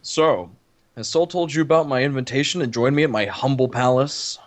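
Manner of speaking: low grandiose voice. Acted.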